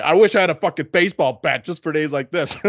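high-pitched mock driver threat; ranting bus driver. Passenger quotes the bus driver's outburst to co-hosts, mock ranting. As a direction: act out a driver venting at the passengers. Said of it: "Yeah, the driver".

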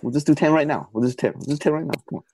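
hammed-up friendly nagging; mock coaxing. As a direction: rope a friend into it, pleading lightly. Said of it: "he'll try to rope you into".

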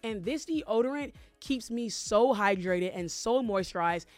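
upbeat podcast ad read; sponsor ad read. Acted. Host pitches deodorant to listeners, sponsor ad read. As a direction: sell it to listeners, emphatic and measured.

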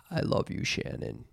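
put-on relaxed voice, drifting off; comatose low voice. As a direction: let it sink into a drowsy near-whisper. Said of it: "sound like you're in a coma".

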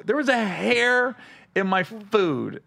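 each word laid out slowly and deliberately; slow, pointed complaint. Retold or imitated. Slowly telling the manager about the hair in his food. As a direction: spell it out to the manager, slow and firm. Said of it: "I go".

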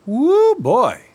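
high, loud whooping exclamation; excited whoop. Acted. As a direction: let out an excited holler. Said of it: "Sparky said".

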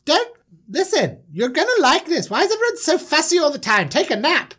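high, fussy, insistent voice; bossy little manager. Acted. Manager brushes off fussy brothers, high and pushy. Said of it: "And he's like".

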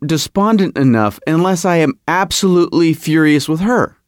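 ordinary talk

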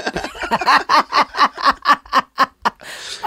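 long, high, real laughter; delighted laugh. Real, not acted. Telling the Bob Saget story, laughing for real. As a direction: crack up at the memory.

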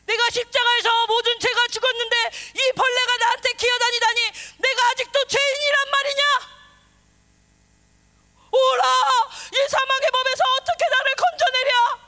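high, loud, tearful lament; Paul wailing. Retold or imitated. Preacher acting Paul's anguish over sin, loud and weeping. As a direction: wail it out as a tormented saint. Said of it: "바울도 막 통곡하며 싸워".

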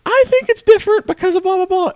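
high, insistent voice of a contrary lady; mock argumentative woman. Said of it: "And she's like".

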